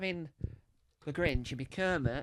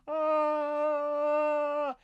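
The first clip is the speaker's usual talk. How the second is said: falling scream; 12 semitones higher, 4 dB louder than usual. Acted. Villain falls off the tower.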